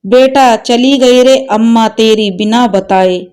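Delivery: usual speaking voice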